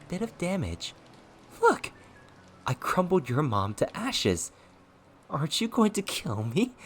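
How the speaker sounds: high, swooping, mocking lilt; childish taunting. A narrator's reading. Possessing ghost daring his enemy to kill, childish taunting. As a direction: needle him like a bratty child. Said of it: "He taunted with childish noises".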